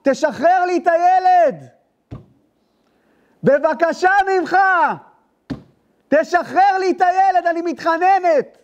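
גבוה מאוד ואיטי, מתחנן; לשחזר את זעקת האישה כהד בראש; צעקת תחינה של האישה. Retold or imitated. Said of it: "שאשתי צעקה". (זעקת תחינה גבוהה ואיטית של אישה, כמו הד בראש)

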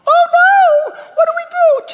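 high, loud squealing mock panic; hammed-up panicking shoppers. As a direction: ham up a frightened crowd.

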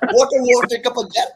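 mock scolding.

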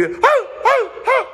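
very high, loud hooting scream; imitating a man hooting in panic on a dropping plane; mock panicked hooting. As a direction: hoot like a terrified man on a plane. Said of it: "gonna be".

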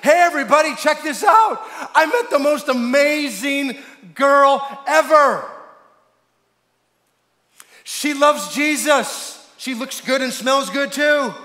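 high, loud, gushing announcement; excited young man. Acted. Young man running in to announce his girl, acted thrilled shout. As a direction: burst in shouting the great news. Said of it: "a young godly man comes running in going".

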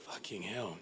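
very soft, exasperated aside; muted exasperation. Retold or imitated. Customer worn down by the phone runaround, quietly exasperated. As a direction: let it out under the breath. Giving up on the call.

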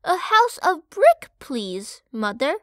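proud youngest pig with a sure plan; bright eager piglet. Acted. Park telling his mother he wants brick, eager. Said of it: "answered Park".

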